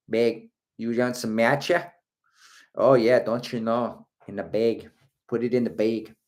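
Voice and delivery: mock Dakota-Wisconsin accent. Host shows the accent gone wrong, Wisconsin accent. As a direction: put on broad upper-Midwest vowels. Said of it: "that's straight Dakota. That's, uh, Wisconsin".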